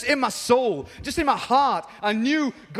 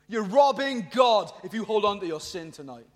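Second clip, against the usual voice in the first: ordinary talk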